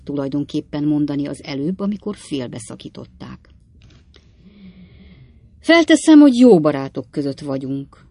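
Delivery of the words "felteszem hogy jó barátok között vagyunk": throat-clearing Pickwick. A narrator's reading. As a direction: clear the throat, then speak with care. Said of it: "a torkát köszörülve". (torokköszörülve, óvatosan)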